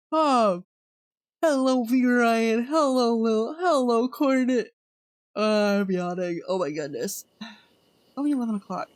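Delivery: usual speaking voice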